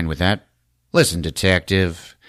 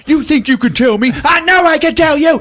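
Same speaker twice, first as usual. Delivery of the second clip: much higher, loud yelled argument; mock shouting match; 8 dB louder, faster than usual. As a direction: yell it back and forth in a squabble. Captain and agent start fighting.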